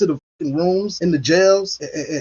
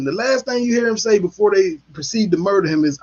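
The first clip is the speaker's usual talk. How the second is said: usual speaking voice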